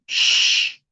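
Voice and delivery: long voiceless shush; hushing "sh". Shown as a demonstration. Teacher demonstrating the first sound of X, sustained shush. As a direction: hush with a sustained "sh". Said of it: "el sonido del silencio".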